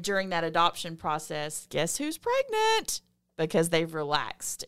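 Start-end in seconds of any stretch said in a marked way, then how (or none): 1.7-3.0 playful reveal. Real, not acted. Punchline reveal.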